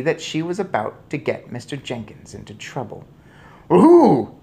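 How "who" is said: high, very loud question; urgent cry. A narrator's reading. Father demands the culprit, crying out. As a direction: burst out with the question. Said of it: "he cried".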